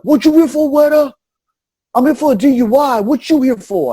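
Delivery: high, loud mock gang-guy voice; tough guys asking. Mocking the chain gang guys' greeting, high and loud.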